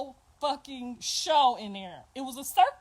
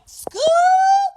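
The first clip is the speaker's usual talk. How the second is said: very high, much louder rising yell; shouted Scoop; 14 dB louder than usual. Retold or imitated. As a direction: yell it like a gang call. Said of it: "she was like".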